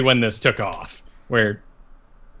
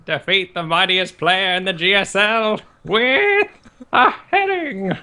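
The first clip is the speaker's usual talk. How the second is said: slow, high-pitched mock command, rising much higher at the end; Monty Python; 7 semitones higher than usual. Player sets a GSL quest, comic knightly impression. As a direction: draw out an absurd quest demand in a put-on voice. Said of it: "Monty Python".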